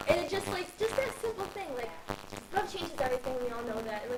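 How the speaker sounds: usual speaking voice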